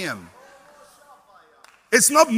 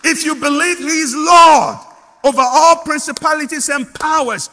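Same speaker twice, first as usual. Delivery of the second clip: louder and higher, building to a proclamation; rising preaching shout; 5 semitones higher, 7 dB louder than usual. Real, not acted. Preacher to congregation, loud proclamation. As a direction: preach it out with full force.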